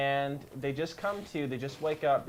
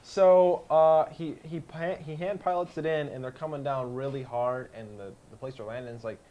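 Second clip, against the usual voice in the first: usual speaking voice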